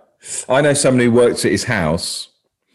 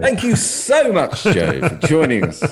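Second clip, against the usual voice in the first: presenter voice; 8 semitones higher than usual. Pretend. Mock host sign-off to guest.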